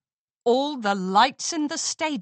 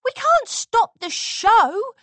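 high, loud panicky protest; panicked; 12 semitones higher, 7 dB louder, slower than usual. A narrator's reading. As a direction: blurt it out in panic.

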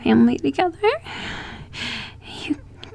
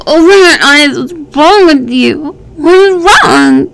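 higher, louder, desperate; frantic yell; 4 semitones higher, 7 dB louder than usual. Acted. Distraught lover yelling at unresponsive partner. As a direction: demand an answer, panicking.

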